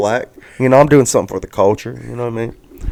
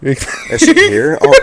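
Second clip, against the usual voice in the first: laughing alarm; much slower than usual. Real, not acted. Fears the ex is present.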